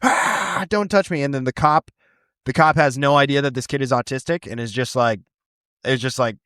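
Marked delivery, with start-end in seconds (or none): 0.0-1.2 high mock scream; mild high-pitched mock yell; retold scream. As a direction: yelp it like a panicked kid. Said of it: "he's screaming at him".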